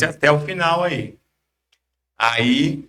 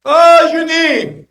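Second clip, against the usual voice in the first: high, slow call across a yard; yelling across the room; 13 semitones higher, 6 dB louder, slower than usual. Retold or imitated. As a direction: call out from far across the lot. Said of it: "ele me gritou do outro lado".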